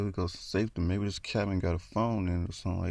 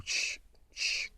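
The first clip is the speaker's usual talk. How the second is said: soft imitated rustling; two hushed "shh" noises; creeping rustle; 5 dB quieter than usual. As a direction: imitate something creeping through brush. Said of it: "it just kept creeping through".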